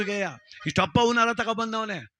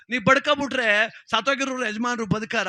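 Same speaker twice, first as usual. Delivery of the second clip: raised challenge; 5 semitones higher, 5 dB louder than usual. Acted. Arguing with the widow.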